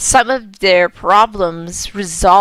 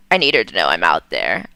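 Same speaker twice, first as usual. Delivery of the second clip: much lower, hurried mother's voice; Vera, low and quick; faster than usual. Acted. Vera's ghost speaks to her daughter, low and hurried. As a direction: drop deep into the ghost mother's voice. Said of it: "that's her voice".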